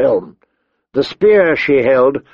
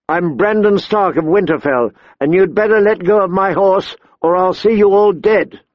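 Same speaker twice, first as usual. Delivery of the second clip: put-on higher-pitched boy standing his ground; defiant young lord; 6 semitones higher than usual. A narrator's reading. Boy lord to robbers holding his horse, defiant. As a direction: threaten them with a child's authority.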